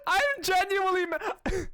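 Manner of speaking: high giggling admission; giggly high confession; laughing confession. Real, not acted. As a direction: laugh through the words. Laughing self-deprecating confession.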